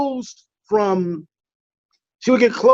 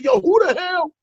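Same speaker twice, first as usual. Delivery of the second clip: startled yell; 10 semitones higher than usual. Retold or imitated. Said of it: "I'm like".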